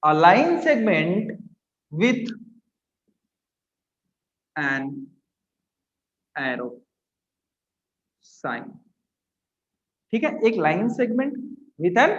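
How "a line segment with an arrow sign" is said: very slow, word by word with long gaps; dropping lower and softer as the words come; writing-along dictation. Real, not acted. Teacher writing a definition for class, halting dictation.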